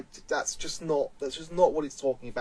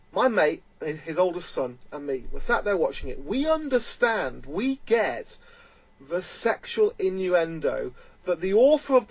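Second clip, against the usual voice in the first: ordinary delivery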